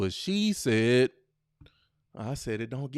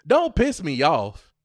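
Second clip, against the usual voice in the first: louder, slower threatening line; mock angry warning; 4 semitones higher, 8 dB louder than usual. Shown as a demonstration. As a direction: warn him with heat.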